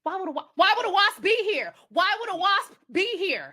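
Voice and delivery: loud, high-pitched disbelief; incredulous outburst. Real, not acted. Host baffled by the wasp in the suit, incredulous outburst. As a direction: demand it loudly, word by word.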